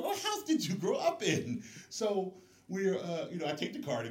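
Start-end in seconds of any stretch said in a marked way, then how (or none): 0.0-1.6 high, loud, laughing amazement; mock astonished question, laughing; laughing disbelief. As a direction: crack up asking him where he learned it. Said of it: "I'm looking at him like".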